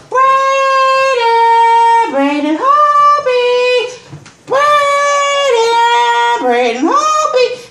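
high, loud sing-song call of Braden; screaming his name. Retold or imitated. Calling out to Braden at the parade, high loud scream. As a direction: holler for him across the parade. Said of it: "I had to scream for 'em".

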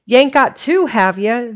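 loud, high, suspicious question; suspicious demand. A narrator's reading. Specs questions Jimsy about the turkey, demanding with suspicion. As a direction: demand it as if doubting him. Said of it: "demanded Specs with suspicion".

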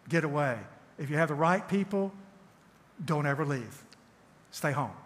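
ordinary delivery

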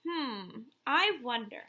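ordinary talk, nothing marked

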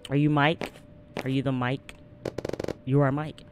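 ordinary delivery

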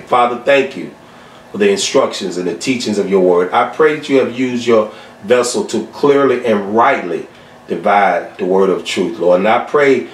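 closing prayer. Acted. Pastor closing the lesson in prayer to God, low and reverent. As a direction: pray it low and humble, letting it die away.

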